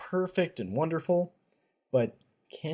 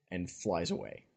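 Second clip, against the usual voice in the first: ordinary talk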